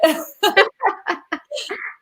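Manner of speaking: a long, high, real giggle; high laugh. Real, not acted. Guest laughing on the live show at her kid-free night. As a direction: laugh freely at her own joke.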